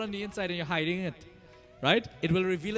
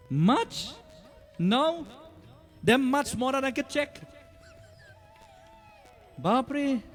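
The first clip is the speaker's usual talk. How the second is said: incredulous; 7 semitones higher, much slower than usual. Real, not acted. Incredulous rebuttal, shout heard.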